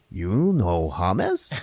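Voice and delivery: slow, stretched-out incredulous question; slow put-on character voice; Lavash. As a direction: draw out each word in disbelief. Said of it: "Lavash is like".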